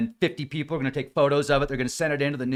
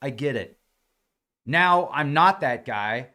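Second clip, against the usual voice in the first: usual speaking voice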